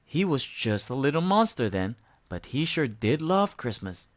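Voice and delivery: plain reading voice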